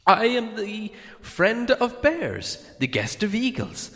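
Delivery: high, proud hobbit boasting; pleased hobbit. A narrator's reading. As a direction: boast it, growing pleased with yourself. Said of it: "beginning to be pleased with himself".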